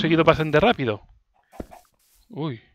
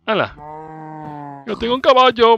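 delighted; 3 dB louder than usual. Real, not acted. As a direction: gloat about the new horse. Delighted to own horse.

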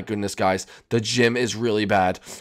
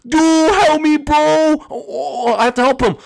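high, loud desperate plea; a trapped friend begging for help; trapped friend pleading; 13 semitones higher, 8 dB louder, slower than usual. Acted.

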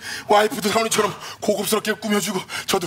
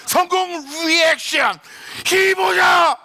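excited announcer; 9 semitones higher, much slower than usual. Acted. Hyping the reaction.

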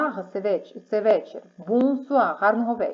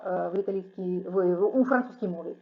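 ordinary talk

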